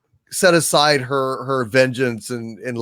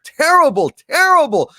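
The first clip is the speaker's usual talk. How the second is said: usual speaking voice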